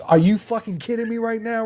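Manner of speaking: high, loud panicked yell; terrified shout at the open plane door. Retold or imitated. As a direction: yell it in sheer disbelief. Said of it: "bro, it was like".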